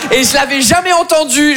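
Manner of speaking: ordinary delivery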